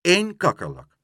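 high, loud correction; indignant insistence. A narrator's reading. Pluk correcting the porter, indignantly insistent. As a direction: stand your ground, piping up.

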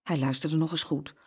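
ordinary delivery, nothing marked

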